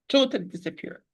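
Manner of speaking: usual speaking voice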